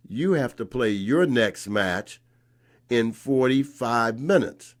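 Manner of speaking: director tells Ashe to play now or default, stern; stern insistence. Retold or imitated.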